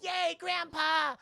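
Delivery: put-on high, slow childish cheer; lightly voiced child's yay; cheering little girl. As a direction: squeal it like a happy kid. Granddaughter cheers grandpa.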